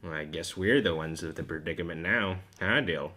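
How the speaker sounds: put-on very low, soft old radio host; deep low voice. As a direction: murmur it like a tired old host. Hosts talk low and quiet.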